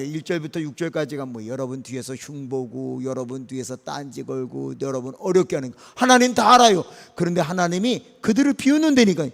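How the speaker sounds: normal talking voice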